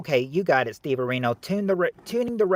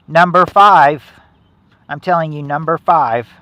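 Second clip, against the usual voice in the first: insistent loud; 14 dB louder than usual. Real, not acted. Insisting answer to chat.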